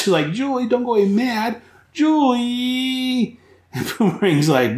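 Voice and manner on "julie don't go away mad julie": much higher, pleading call; high, pleading cry of Julie!; calling after her. Retold or imitated. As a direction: call after someone walking away. Punch calls after Julie.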